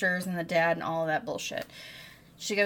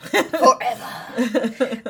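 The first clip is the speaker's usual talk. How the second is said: stretched out slowly through a laugh; a slow, laughing echo of forever; 5 semitones higher, 11 dB louder, much slower than usual. Real, not acted. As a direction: drag the word out, laughing. Echoing co-host, both laughing.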